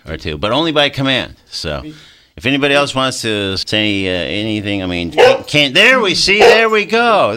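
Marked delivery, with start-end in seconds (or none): 5.1-7.4 rising to a higher, louder burst of delight; excited. Real, not acted. Host delighted when the dog speaks on air, excited. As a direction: cheer as the dog finally speaks up.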